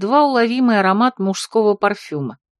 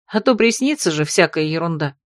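plain reading voice

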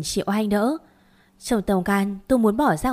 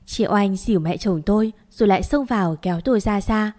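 ordinary delivery, nothing marked

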